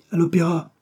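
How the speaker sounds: high, eager exclamation; exclaiming. A narrator's reading. Chief clerk choosing the theatre, exclaiming. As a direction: pipe up with the answer, pitched high. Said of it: "s'écria le principal".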